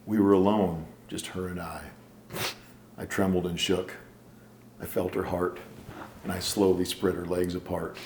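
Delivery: poem recital. Shown as a demonstration. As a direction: read it like a tender love poem. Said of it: "poem I wrote about you".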